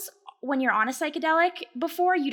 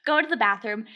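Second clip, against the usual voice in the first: ordinary talk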